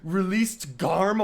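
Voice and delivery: Released Garm drawn out in horror; shocked disbelief. Retold or imitated. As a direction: gods gasping at news, appalled question. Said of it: "everyone goes like".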